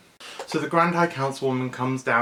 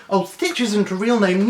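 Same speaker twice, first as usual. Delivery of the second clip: higher, dismissive; mild scoffing sister; 8 semitones higher, 7 dB louder than usual. Retold or imitated. A sister mocking the pet's name, lightly voiced. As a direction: brush the name off.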